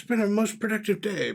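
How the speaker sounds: a well-pleased scholar thinking aloud; a touch of old-fashioned formality; musing gentleman researcher. Acted. Plays researcher talking to himself.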